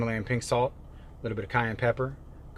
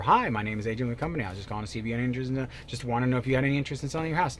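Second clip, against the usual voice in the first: gabbled fast like a bored telemarketer; rushed cold call; 3 dB quieter, much faster than usual. Pretend. Coach mimicking agent Adrian cold calling, rushed gabble. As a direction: rattle off the script without a breath.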